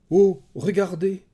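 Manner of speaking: loud, high startled exclamation; crying out. A narrator's reading. Andromache seeing a shape in the mist, crying out. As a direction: burst out pointing at the sight. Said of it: "s'écria à nouveau Andromaque".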